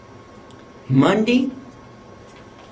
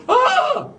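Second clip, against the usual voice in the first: very high sudden shriek of surprise. Retold or imitated. The lady shrieking at the daughter's question. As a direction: let out a piercing ah. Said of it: "like this".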